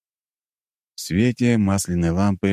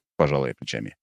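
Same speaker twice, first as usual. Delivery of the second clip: usual speaking voice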